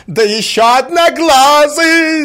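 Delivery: very high, loud, stretched yell; shouted insult. A narrator's reading. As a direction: scream the jibe at him. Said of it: "закричала Марья Александровна". (злобно закричать)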